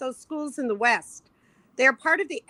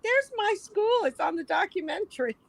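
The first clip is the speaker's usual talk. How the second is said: client's excited recognition; 7 semitones higher than usual. Retold or imitated. The client spotting his school on the documentary, high and amazed. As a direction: point at the screen in amazement. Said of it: "my client saw his school and went".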